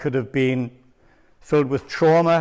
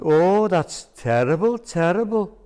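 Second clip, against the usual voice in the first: mock sorrowful Welsh accent, drawn out; 5 semitones higher, slower than usual. Prank caller faking Welsh sympathy to his friend, Welsh accent. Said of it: "please excuse the Welsh accent".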